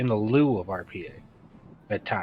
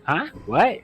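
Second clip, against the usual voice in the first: startled; 9 semitones higher, 4 dB louder than usual. Retold or imitated. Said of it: "and you're like".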